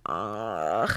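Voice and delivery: low, soft fed-up ugh; mock weary groan; disgusted groan. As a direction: groan at the idea, low and quiet. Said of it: "you're just like".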